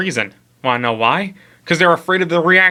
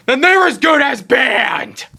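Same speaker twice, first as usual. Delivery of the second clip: higher, louder, slow emphatic shout; 11 semitones higher, 3 dB louder, slower than usual. Acted. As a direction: shout the comeback. Punchline rebuttal.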